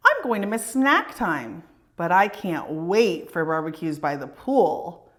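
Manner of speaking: put-on lively read-aloud for children; storybook reading. A narrator's reading. Reading a picture book aloud.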